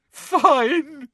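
high, drawn wail; hammed-up crying surrender; sobbing. A narrator's reading. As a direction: burst out tearfully. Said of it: "now sobbing into her hands".

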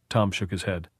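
plain reading voice

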